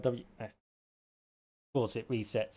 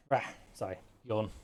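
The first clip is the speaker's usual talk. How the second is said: ordinary delivery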